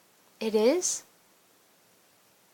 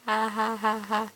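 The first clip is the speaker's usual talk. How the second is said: laughing; 4 dB quieter than usual. Acted. Laughs at own joke.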